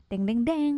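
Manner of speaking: mock horror jingle; playful imitated soundtrack sting; mouth-made suspense sting. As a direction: voice a three-note dramatic cue, rising at the end. Mouth-made sting before the first story.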